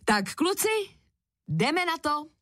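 high, lifted announcement from a dad; rally the kids for the big go. A narrator's reading. Dad to his boys at the slide, rallying. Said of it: "prohlásil tatínek".

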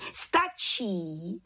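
lightly voiced head witch's call. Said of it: "zvolala vrchní čarodějnice".

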